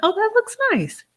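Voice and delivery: high, sugary compliment on his plate; sweet compliment. Shown as a demonstration. Showing women how to compliment a man's food, high and sweet. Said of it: "All you can do is compliment".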